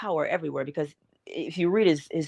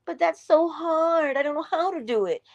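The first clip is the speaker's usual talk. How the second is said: whiny listener; 9 semitones higher than usual. Retold or imitated. Imitating a reluctant listener.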